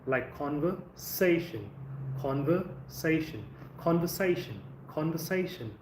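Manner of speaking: "conversation" slow, weight on "sa"; drawn out "conversation", stress on "sa" before "tion"; teacher models "conversation"; stress before shun. Shown as a demonstration. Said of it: "So you have to stress here".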